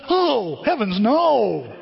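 slow, high, hammed-up "Oh, heavens, no"; mock horror. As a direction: recoil in mock horror at the rival team. Joking about Cubs gear.

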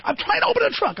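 high, loud exasperated shout; frustrated yell. Retold or imitated. Husband shouting at wife over the jammed car trunk, exasperated yell. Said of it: "I'm like".

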